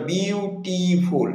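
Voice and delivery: slow বিউটিফুল with its three beats spelled out; syllables drawn out. Shown as a demonstration. Teacher drawing out বিউটিফুল for the class. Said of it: "কিন্তু বিউটিফুল দেখো তিনবার".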